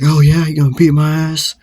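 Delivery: retold hostile challenge; taunting dare. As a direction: act out daring an opponent. Acted dare in fight.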